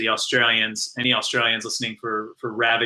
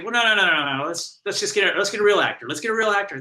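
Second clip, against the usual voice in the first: higher-pitched, mildly flustered refusal; flustered protest; 6 semitones higher, faster than usual. Retold or imitated. Writer turning down the role to producers, mildly flustered. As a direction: wave the idea away, re-enacted. Himself declining the role, retold.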